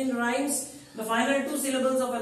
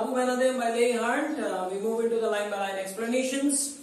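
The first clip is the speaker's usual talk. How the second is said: ordinary delivery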